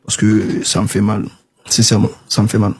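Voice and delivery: normal talking voice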